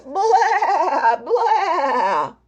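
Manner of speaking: wavering high bleats; goat bleats. Acted. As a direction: bleat twice like a frightened goat. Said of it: "As soon as Goat began to bleat".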